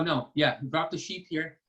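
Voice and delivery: ordinary delivery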